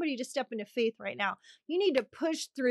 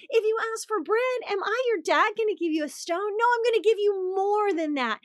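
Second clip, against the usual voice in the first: pitched well higher, fatherly and eager; God as a loving dad; 8 semitones higher, 3 dB louder than usual. Acted. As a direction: speak as a proud father promising more.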